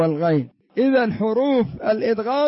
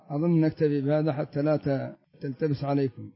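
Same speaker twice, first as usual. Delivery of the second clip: softer; 6 semitones lower, 6 dB quieter than usual. Real, not acted. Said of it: "nobody names it".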